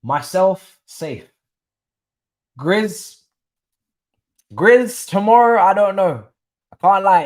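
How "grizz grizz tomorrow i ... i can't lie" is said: loud, playful call to a viewer. Real, not acted. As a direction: hail him across the room. Calling out a viewer.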